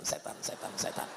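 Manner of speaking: "setan, setan" fading to a whisper. Shown as a demonstration.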